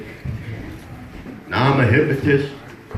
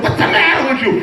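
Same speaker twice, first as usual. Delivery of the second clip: a retold outburst, pitched way up; mock shout; 11 semitones higher than usual. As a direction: yell it at her in disbelief. Husband yelling at his wife.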